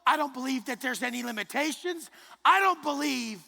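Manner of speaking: high shouted declaration of belief; shouting. Real, not acted. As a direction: bellow it with conviction. Preaching climax.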